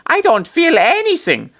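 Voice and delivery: very high, put-on little voice; squeaky character voice. A narrator's reading. Popo answers Chris in the story, high squeaky voice. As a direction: pipe it up far above your own pitch.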